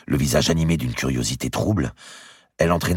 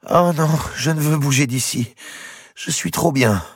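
happy moan; 4 dB louder than usual. Acted. Blanche refusing to leave her lover, a happy moan. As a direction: sigh it out, too content to move. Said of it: "répondit dans un gémissement heureux".

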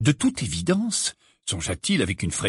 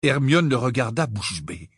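plain reading voice

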